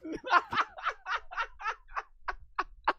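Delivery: shrill burst of shocked laughter; high shrieking laugh. Real, not acted. Shocked reaction to clip.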